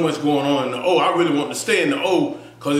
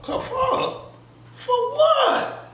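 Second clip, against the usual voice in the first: much higher, incredulous outburst; shocked disbelief; much slower than usual. Retold or imitated. Arrested in the kitchen, asking the sergeant why, incredulous high squeal.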